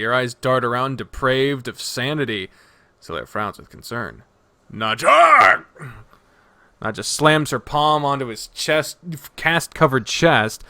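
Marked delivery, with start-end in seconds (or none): 4.7-5.6 loud, raised call of a name; alarmed call. Acted. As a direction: call out sharply to snap someone back. Solaire calls out to spaced-out Nadja.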